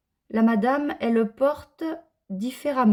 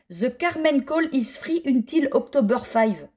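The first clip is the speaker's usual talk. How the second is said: slow English in a French accent; slower than usual. Real, not acted. Vlogger reading out a free pattern offer, French-accented English. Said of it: "Excusez mon accent".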